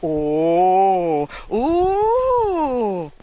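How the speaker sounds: hooting mock scream, held and sliding up; mock scream of oohs. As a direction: wail the oohs in exaggerated reaction. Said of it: "they scream at me".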